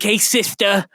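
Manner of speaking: a light sleazy rapper voice. Retold or imitated. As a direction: tease like a sleazy rapper hitting on a girl. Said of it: "He's like".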